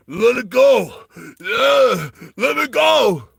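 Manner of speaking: high, hammed-up straining yell; Rambo-style shout. Restrained climber fighting two men off, Rambo-like mock shout. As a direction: bellow and grunt as if breaking free. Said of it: "just like in Rambo".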